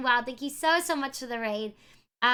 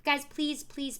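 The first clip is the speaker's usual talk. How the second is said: ordinary talk, nothing marked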